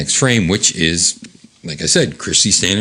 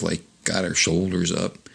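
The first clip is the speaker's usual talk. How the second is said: plain reading voice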